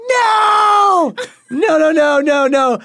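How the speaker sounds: hammed-up panicked wife; imitating shrieked no's. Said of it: "And she goes".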